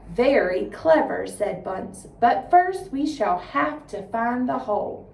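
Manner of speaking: lively, expressive storytelling voice; dramatic story reading. Shown as a demonstration. 4-H agent demonstrating a dramatic reading for students, expressive storytelling. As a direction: read it out like a performance for kids.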